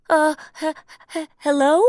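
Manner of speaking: high, stammering nervous hello; scared stammer. Acted. Mickey calls nervously to a ghostly shadow. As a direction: call into the dark, frightened.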